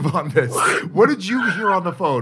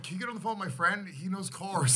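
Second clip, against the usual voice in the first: quiet quick retold quote; 13 dB quieter than usual. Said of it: "uh, I was like".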